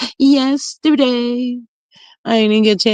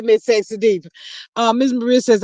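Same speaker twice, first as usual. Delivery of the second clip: normal talking voice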